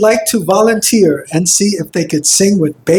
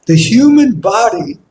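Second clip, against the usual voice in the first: normal talking voice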